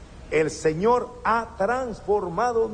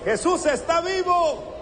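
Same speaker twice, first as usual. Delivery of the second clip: rousing call; 12 semitones higher than usual. Shown as a demonstration. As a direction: lead the crowd in the chant. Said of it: "A ver".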